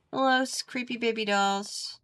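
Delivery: ordinary delivery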